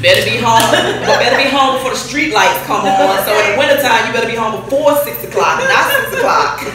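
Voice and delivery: half-laughing imitation of a curfew warning; laughing parental rule. Recalling her strict parent's curfew, laughing mock warning.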